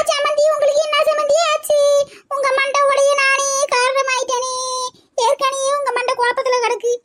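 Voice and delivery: high, hammed-up lament; mock wailing. Woman wailing over the unconscious mother-in-law, hammed up. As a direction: overplay the panic and guilt.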